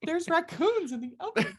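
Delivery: high-pitched, laughing line reading; hammed-up movie distress; mock helpless wife. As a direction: send up a panicked housewife through giggles. Mock acting of the actress's lines.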